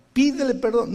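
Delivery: high, loud insistent command; firm Spirit command. Retold or imitated. The Spirit insisting the preacher apologise, high loud command. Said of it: "Y la voz del Espíritu".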